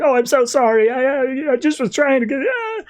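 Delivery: high-pitched, whiny mock apology; frantic apology. Dad apologizing to his kids for being late, frantically apologetic. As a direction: wail an over-the-top, faltering sorry. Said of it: "he's so apologetic".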